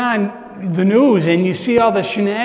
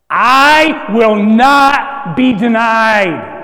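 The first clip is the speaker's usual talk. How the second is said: loud, slow, proclaiming; booming declaration; 3 semitones higher, 8 dB louder, much slower than usual. Acted. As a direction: declare it slowly with full force. Preacher voicing God's declaration.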